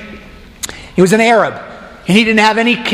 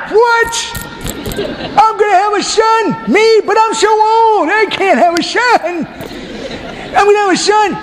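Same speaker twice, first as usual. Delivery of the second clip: flabbergasted Abraham; 13 semitones higher than usual. Acted. Abraham hears God promise a son, high comic yelping. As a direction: yelp in amazement, an old man stunned.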